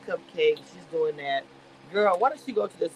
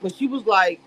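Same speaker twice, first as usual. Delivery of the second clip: ordinary talk, nothing marked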